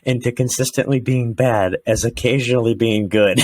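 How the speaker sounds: deadpan quote of a comedian's line, barely shifted; quoting Mike Polk. Retold or imitated. Quoting the Factory of Sadness bit, lightly voiced. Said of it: "where he says".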